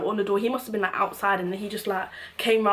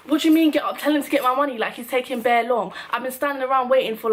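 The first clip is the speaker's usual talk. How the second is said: angry retort; 4 semitones higher, 5 dB louder than usual. Retold or imitated. Retold row with the bouncers.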